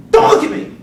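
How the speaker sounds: sudden high, loud yell; threatening yell. Retold or imitated. Carjacker yells at the driver not to look, loud threat. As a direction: bark it as a threat that makes hearts jump.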